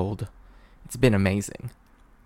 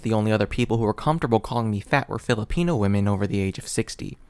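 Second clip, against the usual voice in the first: ordinary talk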